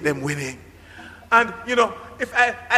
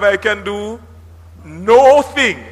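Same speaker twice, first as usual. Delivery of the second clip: "no thing" split and punched loud; stress on no thing; 4 dB louder, slower than usual. Shown as a demonstration. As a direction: hit "no thing" as two hard words. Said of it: "Pardon the stress and the emphasis".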